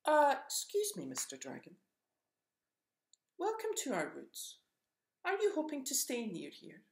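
high, timid squirrel voice; a small creature trying to be polite; nervous squirrel. Acted. Squirrel nervously greets the huge dragon, high and timid. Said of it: "Squirrel looked at the scary dragon and gulped".

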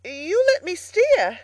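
mildly frightened, high, loud, slow plea. A narrator's reading. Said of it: "now thoroughly frightened".

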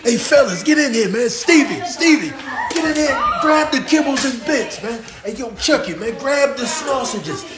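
a high, hyped animal voice; excited pet. Acted. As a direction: call the gang over, thrilled.

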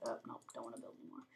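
low, fast mumbled quote; mock quote. Gamer quits building, a low fast mock grumble. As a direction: toss it off low and quick. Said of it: "I'm just like".

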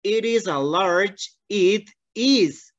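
slow model phrase. Shown as a demonstration. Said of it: "No vamos a leer la palabra".

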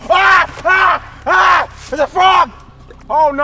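loud high terrified screams; panicked screaming. Real, not acted. Frog appears, screaming.

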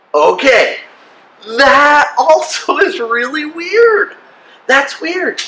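drawn-out, high-pitched exclamation; amused disbelief. Real, not acted. Pastor reacts to a strange verse, amused disbelief. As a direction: stretch it high in amused bafflement.

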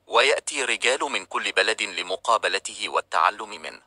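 plain reading voice

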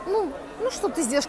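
ordinary talk, nothing marked

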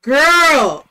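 loud startled yell; startled shout. Real, not acted. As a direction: yell in sudden fright. Startled by monster.

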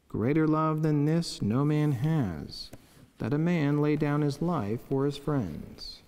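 ordinary talk

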